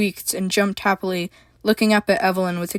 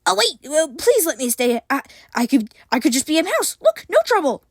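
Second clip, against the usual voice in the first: high, hurried pleading creature voice; desperate yelp; 8 semitones higher than usual. A narrator's reading. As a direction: beg to stay in a panic. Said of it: "he yelped".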